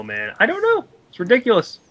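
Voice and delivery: ordinary delivery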